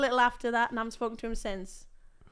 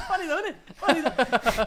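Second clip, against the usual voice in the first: high, giggly self-mocking excuse; re-enacted sheepish morning-after giggle; laughing it off; 6 semitones higher, 5 dB louder than usual. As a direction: laugh through it as you plead your case. Acts out laughing off her embarrassment.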